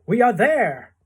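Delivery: high, loud cheery announcement; trying to be cheerful. A narrator's reading. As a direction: announce arrival with determined cheer. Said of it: "trying to be cheerful".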